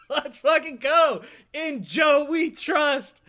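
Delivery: high, slow, stretched cheer; elated hype. Real, not acted. Host hyped by caller's energy, elated drawn-out cheer. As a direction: proclaim it slowly like a victory cry.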